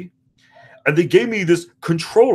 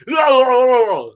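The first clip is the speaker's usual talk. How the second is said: silly singsong; 5 semitones higher, 5 dB louder than usual. Retold or imitated. Button mashing chant.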